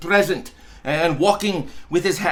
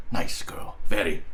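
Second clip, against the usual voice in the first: whispered aside; 9 semitones lower than usual. A narrator's reading. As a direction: murmur it admiringly to yourself. Scrooge's aside under his breath.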